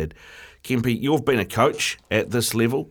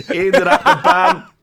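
reading through real laughter, much higher and louder; helpless laughter over the punchline; laughing; 9 semitones higher, 6 dB louder than usual. Real, not acted. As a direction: crack up while reading it out. Laughing while reading a listener text.

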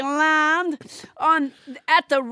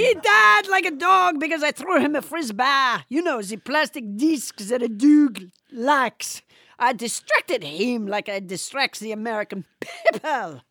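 put-on French accent. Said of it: "French Trump".

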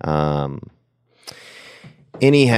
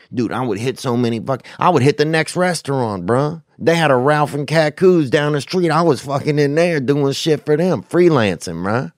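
higher-pitched, worked-up rant; heated bragging; 7 semitones higher than usual. Real, not acted. Ex-busboy bragging about hitting the next restaurant, heated and high. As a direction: get carried away boasting, pitched up. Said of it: "that shit got me heated up".

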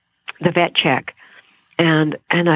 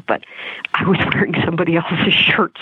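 ordinary delivery

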